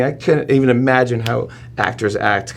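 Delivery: ordinary delivery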